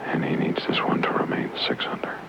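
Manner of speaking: whispered golf commentary; golf commentator. Acted. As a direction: hush as he lines up the putt.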